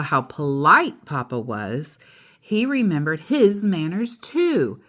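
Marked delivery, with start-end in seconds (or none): none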